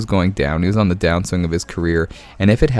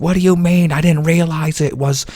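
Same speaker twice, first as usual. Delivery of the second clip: high, mocking customer voice; mock-outraged customer, pitched way up; indignant customer; 9 semitones higher than usual. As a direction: squeak it out like a clueless diner. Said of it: "she's like".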